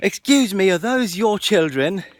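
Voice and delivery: high, loud mock British lady scolding. An angry British lady confronts parents about their kids, imitated high and loud. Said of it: "and she says".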